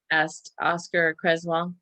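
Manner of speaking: ordinary delivery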